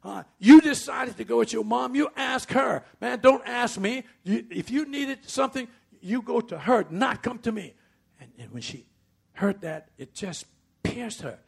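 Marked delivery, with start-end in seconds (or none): 0.0-7.8 high, loud angry rebuke; father yelling. Retold or imitated. Father yelling at his young daughter, loud angry rebuke. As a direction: bark it at the child like a furious father. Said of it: "yelled at her".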